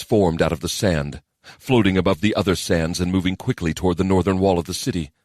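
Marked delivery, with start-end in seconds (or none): none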